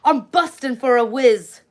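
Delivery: mock Aussie pilot saying it, slow; lightly imitating an Australian pilot; Australian accent. Retelling what the Australian pilot said, in mock Aussie accent. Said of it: "I learned a, uh, Australian term".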